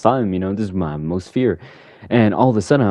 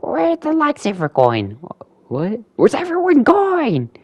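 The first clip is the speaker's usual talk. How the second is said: very high, drawn-out cry; mock panic; slower than usual. Joker lost as it turns dark, high mock panic. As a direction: wail the questions slowly in comic fright.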